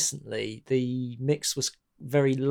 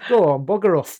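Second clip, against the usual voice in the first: louder Northern English dismissal; Northern accent; 4 semitones higher, 7 dB louder than usual. Retold or imitated. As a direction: shoo him off in a Northern accent. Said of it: "Sorry, my best Northern accent".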